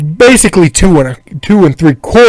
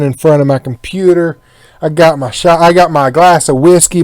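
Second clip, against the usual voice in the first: ordinary talk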